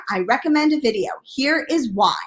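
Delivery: ordinary talk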